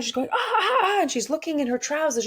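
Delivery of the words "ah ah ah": mock high panicked shrieks. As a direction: imitate her squealing in high alarm. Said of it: "she's kind of freaking out".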